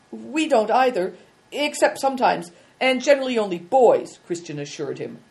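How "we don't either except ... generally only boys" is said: put-on higher, flustered young boy's apology; a boy hurrying to undo his blunder; embarrassed boy. A narrator's reading. Boy explains to general.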